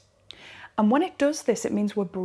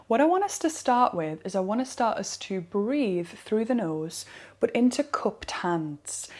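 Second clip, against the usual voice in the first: normal talking voice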